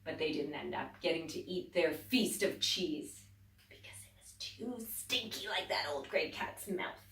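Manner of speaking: ordinary delivery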